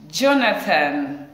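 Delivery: slow, loud drawn-out name; excited reveal. Real, not acted. Teacher revealing the star of the week, drawn out. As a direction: stretch the name out like a big reveal.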